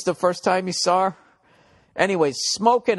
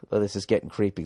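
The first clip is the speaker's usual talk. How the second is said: ordinary delivery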